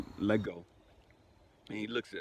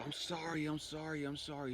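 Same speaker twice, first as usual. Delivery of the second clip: high, softer, repeated tearful plea; imitated crying apology; 6 semitones higher, 4 dB quieter than usual. Store owner consoling the grieving widower, imitated crying. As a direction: imitate someone weeping as he apologises over and over. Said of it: "He's just crying, crying".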